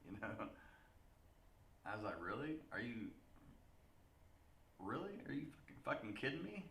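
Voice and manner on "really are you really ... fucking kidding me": retold disbelief.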